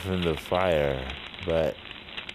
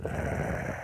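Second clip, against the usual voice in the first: long, low-volume throaty growl; 9 dB quieter than usual. Retold or imitated. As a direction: growl it out like a lurking monster. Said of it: "I just growled out".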